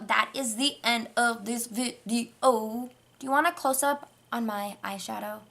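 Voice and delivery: usual speaking voice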